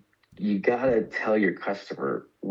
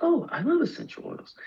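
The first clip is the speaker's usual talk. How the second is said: friend's delighted voice. Retold or imitated. Friend voiced in retold chat.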